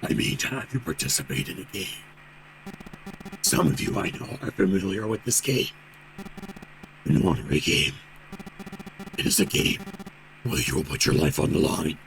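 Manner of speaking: low, half-whispered sinister voice; cold eerie voice. Acted. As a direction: speak low and cold, like a menacing captain. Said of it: "a cold, eerie voice".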